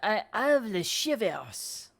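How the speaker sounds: shudder as a French chef. Acted. Chef shaken.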